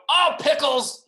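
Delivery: high, loud mock grumble; acted boy's dismay. Boy unhappy that it's bedtime, high loud protest. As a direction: cry it out as a put-out little kid.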